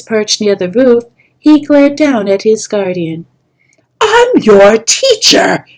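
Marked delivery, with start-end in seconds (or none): 4.0-5.6 loud, slow, high snarl. Acted. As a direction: put the pupil in his place. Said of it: "Kestrel snarled".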